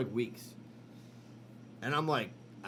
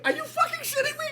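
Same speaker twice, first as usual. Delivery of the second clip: much higher, laughing incredulous question; laughing disbelief; 4 dB louder than usual. Retold or imitated. Maggie reacts to the belt diagnosis with laughing disbelief. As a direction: Maggie can't believe it, laughing as she asks.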